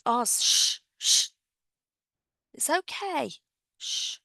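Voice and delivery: calm the dog down gently; soothing a dog. Real, not acted. Hushing the barking dog.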